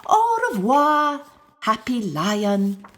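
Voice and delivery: drawn-out fond farewell; sweet old lady voice. A narrator's reading. Old knitting lady bids the lion goodbye, sweetly. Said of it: "never left without saying".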